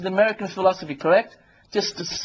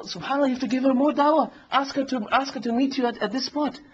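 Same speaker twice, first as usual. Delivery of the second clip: higher-pitched, urging tempter; Shaitan; 6 semitones higher than usual. Acted. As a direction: keep nudging him on, voice raised in pitch. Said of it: "And the shaitan says".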